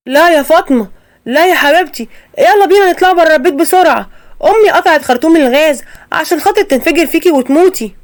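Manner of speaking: high, loud urgent warning; husband rushing his wife out of the gas-filled flat, urgent; urgent alarm. Acted. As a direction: hurry her out, voice up and pressing.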